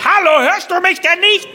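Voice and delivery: high, loud insistent hello; loud call. Retold or imitated. Wife on a bad mobile line, insistent call. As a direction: shout into the phone.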